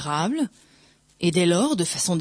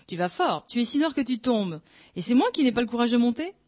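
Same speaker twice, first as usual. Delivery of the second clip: higher, incredulous retort rising on the last clause; indignant question; 6 semitones higher than usual. A narrator's reading. Priest retorting to his taunting friend, indignant disbelief. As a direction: throw it back as an offended question.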